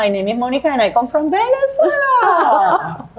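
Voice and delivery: slow, high, careful schoolbook English with a laugh; mock her own beginner English; stiff textbook English. Laughing at her old stiff textbook English. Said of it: "yo tenía inglés de misma".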